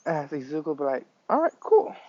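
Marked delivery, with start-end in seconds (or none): 1.3-2.0 eager agreement. Acted. Invisible Girl asks him to text.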